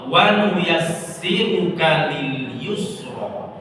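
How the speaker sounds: slow melodic recitation of "Wa nuyassiruka lil yusra"; Quran recitation. Acted. Ustazah reciting the verse for the ladies, slow and melodic. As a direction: draw out each word like tilawah.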